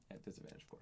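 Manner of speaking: sly whispered aside. Real, not acted. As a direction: drop to a whisper, teasing.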